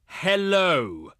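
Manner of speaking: drawn-out, exaggerated HELLO; condescending. Envoy to an uncomprehending listener, condescending. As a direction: stretch HELLO out as if to a dolt.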